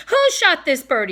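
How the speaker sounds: loud, high demand. A narrator's reading. Warden confronts the crowd, loud demanding voice. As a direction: bark the question at the crowd. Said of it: "he demanded".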